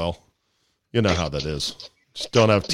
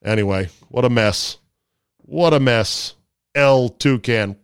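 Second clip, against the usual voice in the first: normal talking voice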